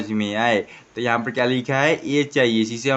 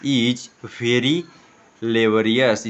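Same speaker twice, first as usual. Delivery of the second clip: very slow, each word spelt out for pupils; slow model reading; much slower than usual. Shown as a demonstration. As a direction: read it out slowly like a teacher. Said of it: "एक बार पढ़ने का प्रयास करें". (धीरे-धीरे साफ़ पढ़कर सुनाना)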